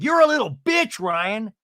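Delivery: high, loud mock-angry insult; Tamra. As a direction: imitate a woman yelling across dinner. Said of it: "flash back to that dinner scene where Tamra's like".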